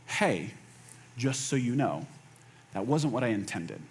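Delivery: low, soft, careful reassurance; gentle clarifying. Acted. Models gentle step-three clarification.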